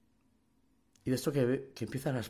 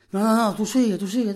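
insistent; 8 semitones higher, 8 dB louder than usual. Retold or imitated. Inmate insisting tattoo continue.

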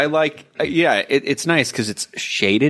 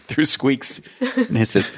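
higher voice, laughing; 6 semitones higher than usual. Real, not acted. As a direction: laugh through the line. Laughing banter.

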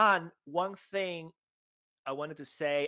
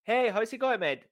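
loud, high, friendly hey mate; cheery greeting; 8 semitones higher, 6 dB louder than usual. Acted. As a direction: call out like a happy friend. Acted phone greeting.

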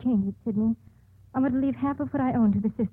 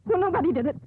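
near-hysterical rambling; 8 semitones higher than usual. Acted. Condemned woman breaks down, rambling.

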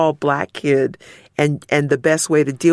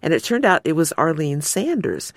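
ordinary talk, nothing marked

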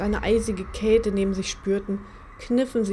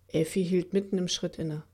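usual speaking voice

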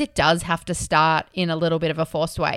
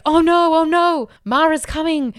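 high, loud mock panic; hammed-up alarm at a demon coming; panicked Ananda; 10 semitones higher, 6 dB louder than usual. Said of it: "freak out about it".

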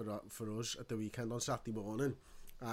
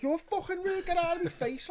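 high, loud snap at the guard; furious shout at a security guard standing too close; retold shout; 7 dB louder than usual. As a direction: yell it right in his face.

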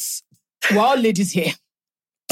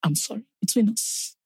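hushed apology; 7 dB quieter than usual. Retold or imitated. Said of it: "I wanted to say".

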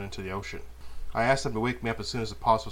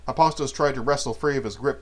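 plain reading voice

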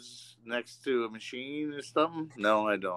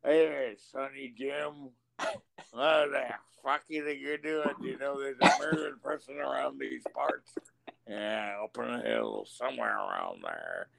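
put-on sheriff voice. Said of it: "You're just doing the voices".

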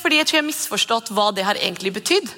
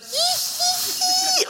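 put-on very high, drawn-out hiding child's giggle; long high giggle of a child hiding; child's hidden giggle; much slower than usual. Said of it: "så bare sitter bare".

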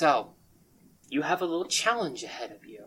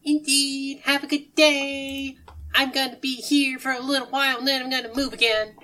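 cheerful odd character; 13 semitones higher, 5 dB louder than usual. Acted. Sushi Kid bidding departing travellers farewell, high and chipper. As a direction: hold each phrase up high, stretching the endings.

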